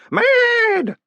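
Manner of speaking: "Mad!" drawn out, very high and loud; savoured "Mad!". Acted. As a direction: relish the word "Mad!" and hold it. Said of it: "enjoying the syllable so much that she seemed loath to let it go".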